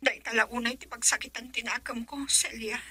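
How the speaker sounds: breathless whispered name. Acted. Whispering to Celia about father's threat. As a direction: whisper her name so no one hears. Secret meeting, hushed plea.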